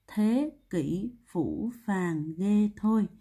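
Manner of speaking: very slow, word by word; slow recitation. Shown as a demonstration. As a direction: recite the memory phrase with each word drawn out. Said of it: "Cô đọc lại nha". (đọc thật chậm từng chữ)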